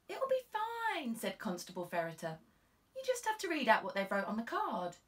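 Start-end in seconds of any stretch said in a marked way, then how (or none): 0.1-1.1 high, slow reassuring constable voice; a cheery junior officer, drawn out; young constable. Acted. 2.9-5.0 a touch higher and louder, eager; helpful junior officer explaining; chirpy constable coaching her sergeant; lightly voiced young constable.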